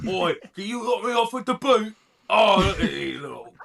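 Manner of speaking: high, loud British lout; trashy British accent. Acted. Trashy British bloke complaining about the boot, high loud British accent. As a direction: moan loudly in rough British slang. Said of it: "one of those trashy British people".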